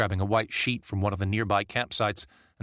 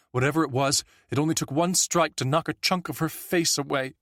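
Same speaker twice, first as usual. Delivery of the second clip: higher, tense voice of a witness telling what she saw; the bystander recounting the attack, a little higher and urgent; shaken witness; 6 semitones higher than usual. A narrator's reading. A witness telling Mark about the killing, tense and higher.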